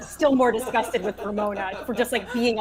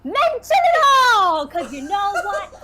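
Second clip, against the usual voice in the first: even higher and louder slow yell with a laughing, crying break; acted shout breaking toward tears; 9 semitones higher, 7 dB louder, slower than usual. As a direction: push past the first shout, voice cracking. Acted quarrel, near tears.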